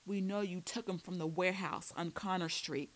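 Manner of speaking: plain reading voice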